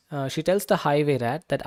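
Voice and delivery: usual speaking voice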